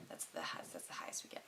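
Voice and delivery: retold shop clerk, low and whispered; whispering clerk. Retold shop assistant telling her about sizes, in a low whisper. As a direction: whisper it like the clerk breaking bad news. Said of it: "And she goes".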